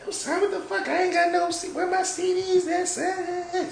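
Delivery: mock angry friend in falsetto; high-pitched imitation. Storyteller mimicking homeboy missing his CDs, high-pitched. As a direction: push the impression way up high. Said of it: "high pitch".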